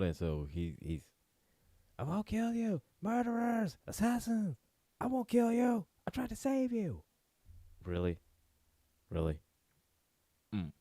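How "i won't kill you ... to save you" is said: freaking-out madman. Acted. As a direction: play a panicky lunatic in a squeaky-high voice. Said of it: "he's freaking out".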